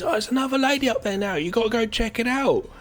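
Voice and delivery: high, eager gossiping villager; excited villager. Retold or imitated.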